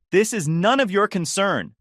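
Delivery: high, loud snap at the stranger; yelling. A narrator's reading. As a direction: shout him down. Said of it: "she yells at him".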